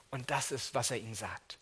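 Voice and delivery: ordinary delivery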